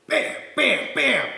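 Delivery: high, punchy TV chef catchphrase; Emeril Lagasse. Retold or imitated. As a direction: shout it like a showman chef. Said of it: "This is an Emeril Lagasse pan".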